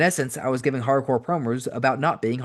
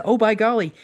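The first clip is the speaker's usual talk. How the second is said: mock doctor; 10 semitones higher, 4 dB louder than usual. Mock doctor over smoker's lung.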